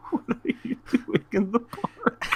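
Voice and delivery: very high, through helpless laughter; breathless with laughing; real laughing. Real, not acted. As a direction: try to quote it while cracking up. Retelling officer while cracking up.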